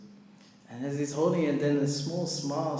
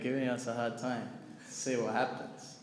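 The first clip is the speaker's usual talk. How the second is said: usual speaking voice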